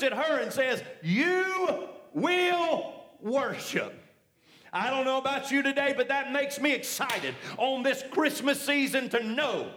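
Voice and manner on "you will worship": slow, drawn-out, every word weighted; slow emphatic proclamation. Acted. Preacher proclaiming Jesus' words to the congregation, drawn out and weighty. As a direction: lean into will, let it ring.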